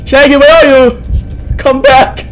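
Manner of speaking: high, loud frightened shouts; panicked calling out. Real, not acted. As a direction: shout for your lost friend. Calling lost companion in panic.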